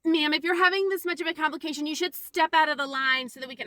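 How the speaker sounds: loud, high mock reprimand; officious scolding. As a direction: imitate a clerk ordering a customer aside. Mimics Mel scolding the customer.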